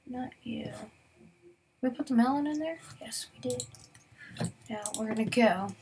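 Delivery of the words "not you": soft unvoiced whisper; a quiet aside, breathed out. Real, not acted. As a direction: whisper it to yourself. Rejecting an item, whispered.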